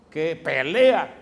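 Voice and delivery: playful slow emphasis; slow punched emphasis. Real, not acted. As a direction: stretch que pelea slowly and loudly. Emphasizes a fighting Jesus.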